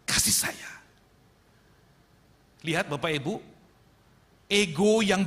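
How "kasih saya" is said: whispered, voiceless "Kasih saya"; a sly whispered "Kasih saya"; whispered demand. Retold or imitated. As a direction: breathe the words "Kasih saya" with no voice. Son repeats his demand, whispered.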